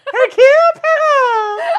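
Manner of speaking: very high, loud squeaky Hercule Poirot; chipmunk voice. Pretend. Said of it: "why do you sound like a chipmunk".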